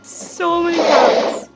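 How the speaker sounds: gushing. Real, not acted. Delighted by crowd of cats.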